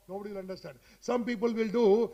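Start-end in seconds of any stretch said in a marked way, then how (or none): 0.1-0.8 much softer, thin and distant, with the mic away from the mouth; mic held too far. Shown as a demonstration. To students, showing a mic held too far away, faint. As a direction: let the voice fade off the mic. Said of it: "Some people keep like this and they will talk".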